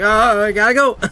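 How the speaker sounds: loud, high mock-awkward excuse; flustered exit. Imitating grandpa fleeing the dying squirrel, flustered. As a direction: blurt it out as an awkward grandpa bailing. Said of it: "he did not know how to handle the situation".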